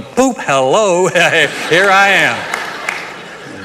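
drawn-out mock surprise entrance, laughing; hammed-up, stretched-out ta-da greeting; playful gloating. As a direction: pop up and taunt them playfully. Imagined popping up before mockers.

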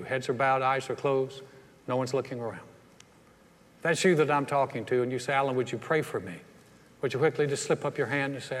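hushed altar call voice. Real, not acted. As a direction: gently invite them to pray. Altar call prayer invitation.

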